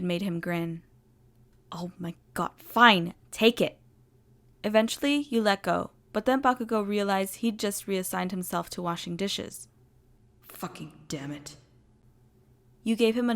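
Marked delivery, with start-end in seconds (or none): 1.7-3.8 annoyed, snapping outburst; fed-up huff then loud snap; exasperated. A narrator's reading. Bakugo gives up annoyed. 10.4-11.6 low, quiet grumbled curse; muttered curse. A narrator's reading. Bakugo realizes he lost.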